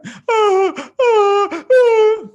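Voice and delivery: put-on high, loud child's wail; hammed-up crying sound; mock wailing. Boy wailing at seeing his father insulted. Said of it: "he starts crying".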